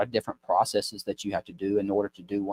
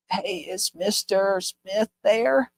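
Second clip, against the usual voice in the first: put-on high, slow, frail old lady voice; sick old lady; 10 semitones higher, much slower than usual. Calling the pharmacy disguised as a sick old lady, high and frail. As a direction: put on a feeble old lady's voice. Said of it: "act like I'm a sick old lady".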